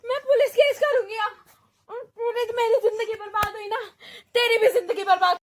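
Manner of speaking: angry tearful threat. Acted. Victim raging and crying.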